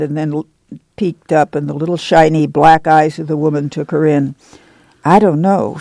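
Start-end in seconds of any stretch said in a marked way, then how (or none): none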